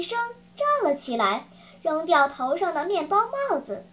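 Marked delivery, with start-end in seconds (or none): none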